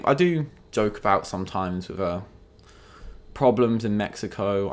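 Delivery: usual speaking voice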